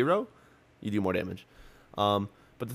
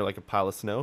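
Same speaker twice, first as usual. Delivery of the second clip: normal talking voice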